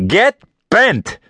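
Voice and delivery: angry retort. A narrator's reading. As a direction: fire it back, furious. She snaps at leering man.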